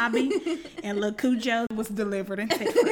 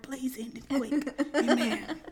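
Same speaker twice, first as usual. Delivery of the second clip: normal talking voice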